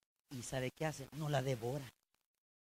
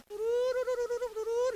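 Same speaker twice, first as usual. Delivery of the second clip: high, slow, loud trilled call; loud trilled "tururu" shout; shepherd's shouted call; 8 dB louder than usual. Retold or imitated. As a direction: call the lost sheep like a shepherd. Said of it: "y empieza a gritar".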